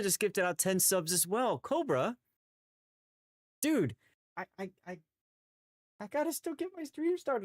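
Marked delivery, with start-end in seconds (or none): 1.6-7.4 excited. Real, not acted. Overwhelmed by gift subs.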